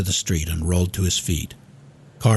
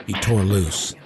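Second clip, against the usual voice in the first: plain reading voice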